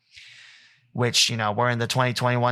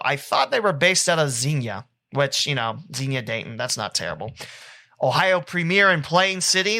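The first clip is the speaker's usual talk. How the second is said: ordinary talk, nothing marked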